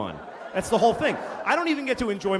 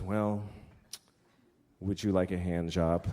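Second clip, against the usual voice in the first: low, softer retold offer as the wife; reluctant offer; 10 semitones lower, 5 dB quieter, slower than usual. Wife offering her husband a consolation, imitated low and reluctant. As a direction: quietly imitate a resigned wife's offer.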